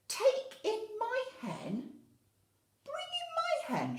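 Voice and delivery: high, loud, laughing disbelief; baffled old woman questioning the plan; puzzled old lady. Acted. Old lady doubting the wise man, high puzzled voice. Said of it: "she asks him".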